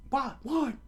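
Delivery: very high falsetto disbelief; astonished squeal. Acted. Crewmate reacts to the boy's plan, astonished squeak. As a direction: squeak it out in shock.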